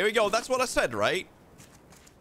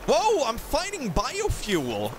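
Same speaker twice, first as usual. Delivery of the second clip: surprised whoa; 10 semitones higher, slower than usual. Real, not acted. Surprised find.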